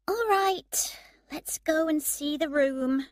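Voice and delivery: high-pitched, resigned giving-in; mildly weary. A narrator's reading. Woman agrees to see the only room, weary. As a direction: give in with a touch of weariness. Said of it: "Emma said wearily".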